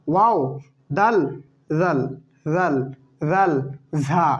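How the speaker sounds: short clipped letter names in a steady chant; read-along recitation. Shown as a demonstration. Teacher asks students to read the letters along. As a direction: lead the class through the letters to repeat. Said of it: "এটা কয়েকবার আমার সাথে পড়ুন".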